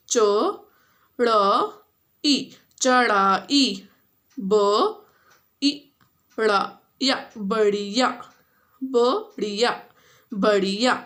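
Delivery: चढ़ाई and बढ़िया built up from single letters; teacher sounding out words. Shown as a demonstration. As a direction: spell each word slowly, then blend. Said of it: "you have to read and then you have to repeat".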